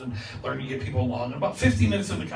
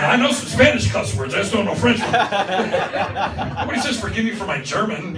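louder and higher, laughing through it; 7 semitones higher, 9 dB louder than usual. Real, not acted. Preacher riffing on cuss words while laughing. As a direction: keep the joke going while cracking up. Guest laughing at own joke.

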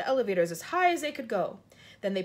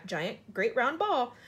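ordinary delivery, nothing marked

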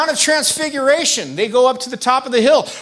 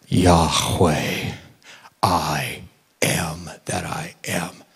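much lower, slow, breathy whisper; hushed divine name; 4 dB quieter, much slower than usual. Acted. Jesus telling the Pharisees his name, in a low slow whisper. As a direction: whisper the holy name as if it can't be said aloud.

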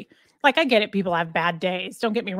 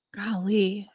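whispered aside. Real, not acted.

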